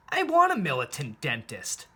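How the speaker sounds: ordinary delivery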